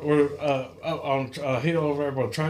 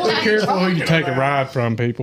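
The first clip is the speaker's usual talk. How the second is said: ordinary talk, nothing marked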